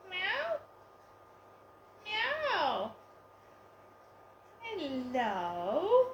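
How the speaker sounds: high, sing-song pet voice calling the cat. Real, not acted. Owner cooing to her lost cat, high baby talk.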